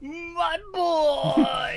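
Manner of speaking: high, loud cheer of delight; excited shout. Real, not acted. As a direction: yell it out with joy. Cheering a hit in game.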